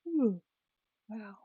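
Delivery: yawning while talking; words stretched by a yawn. Real, not acted. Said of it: "I wasn't yawning until I started filming".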